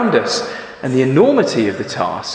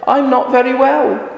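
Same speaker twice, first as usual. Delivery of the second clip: very high, loud sickly rabbit; poorly rabbit; 13 semitones higher, 4 dB louder than usual. Acted. Rabbit claiming to be unwell, very high. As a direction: whimper about feeling ill, way up high. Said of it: "says the rabbit".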